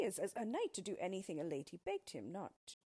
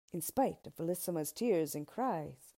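plain reading voice

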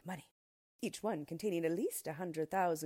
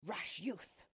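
indignant dwarf; 4 dB quieter than usual. A narrator's reading. Yellow Dwarf confronts the king, indignant character voice. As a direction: challenge him in character. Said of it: "he cried".

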